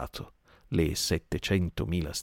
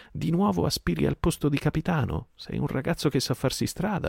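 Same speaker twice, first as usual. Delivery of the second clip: much higher, sardonic teasing; mocking Silver; 10 semitones higher than usual. Silver mocks Merry's bid for captain, sardonic taunt. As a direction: needle him with fake praise.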